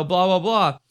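normal talking voice

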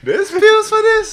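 shrill, drawn-out disbelief; spluttering outrage. Acted. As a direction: squeak it out in mock shock. Mock shock at depression cure.

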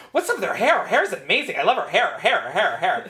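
high, loud gushing over hair; gushing husband. Retold or imitated. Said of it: "the entire episode was like".

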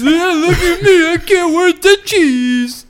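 high, slow, whiny mock voice; whiny high impression. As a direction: whine it high and slow like a bratty partner. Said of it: "you're like".

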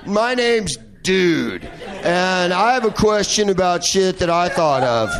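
slow, dopey dude drawl; slow, laughing mock dude asking a question. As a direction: play a slow, clueless guy. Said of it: "now it's like".

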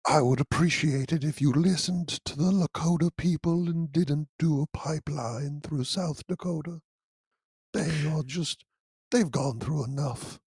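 soft, breathy little bear voice; lightly voiced gentle cartoon bear; Winnie the Pooh voice. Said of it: "Winnie the Pooh was capable of blackmail".

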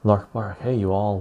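ordinary talk

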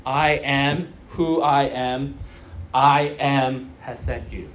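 low, slow and weighty; solemn God. Retold or imitated. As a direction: intone it like a divine name. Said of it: "those words are all capital".

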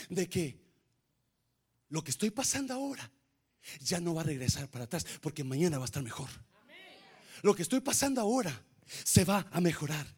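fast, soft, low reassurance; rapid murmured sermon; quick hushed preaching. Acted. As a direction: hurry the comfort out under the breath.

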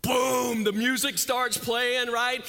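loud, high burst; sudden surge of preaching energy; explosive. Acted. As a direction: shout it as the music kicks in.

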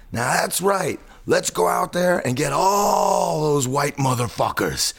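high, drawn-out rallying cry; imitated rallying yell. Guy jumps up and turns to the audience, imitated rallying yell. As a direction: rouse the crowd, stretching the key words. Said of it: "he starts yelling".

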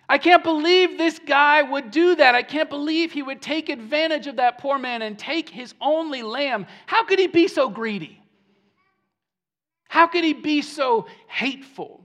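high, loud outraged disbelief, acted out; hammed-up righteous outrage; worked-up indignation. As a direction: play the scandalized, indignant listener. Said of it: "getting all worked up".

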